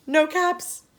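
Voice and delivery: high, loud cry of dismay; surprised dismay. A narrator's reading. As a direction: gasp it out, caps gone. Peddler finds caps gone.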